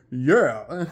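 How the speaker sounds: loud, blunt put-on tough guy; mock tough yeah. Boyfriend claiming to speak for everybody, mock tough yeah. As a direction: imitate a cocky loud "yeah". Boyfriend's cocky answer acted.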